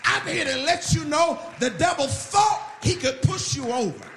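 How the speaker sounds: loud, driving sermon shout; full-force preaching. Real, not acted. Preacher rallying the weary against the devil, full-force shout. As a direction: preach it hard, punching every phrase.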